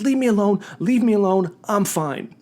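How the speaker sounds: lightly acted, insistent brush-off; retold insistent protest. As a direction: imitate a girl waving off help. Girl jumps up, brushing helpers off.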